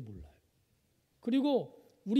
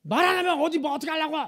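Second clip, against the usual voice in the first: reenacted angry shout, higher and louder; husband angrily retorting to his wife; 7 semitones higher, 7 dB louder than usual. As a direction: snap back at the wife in anger. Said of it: "제가 막 화를 냈어요".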